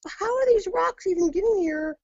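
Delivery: high, bewildered mock exclamation; retold disbelief. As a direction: replay the baffled question at the rocks. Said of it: "And I'm like".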